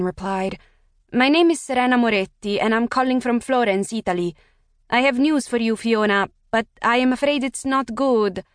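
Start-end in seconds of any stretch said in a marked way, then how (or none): 1.1-8.4 put-on higher, Italian-accented woman on the phone; thick Italian accent. A narrator's reading. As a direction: give her a thick Italian accent, polite and formal. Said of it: "A woman with a thick Italian accent".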